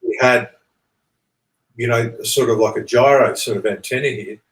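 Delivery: usual speaking voice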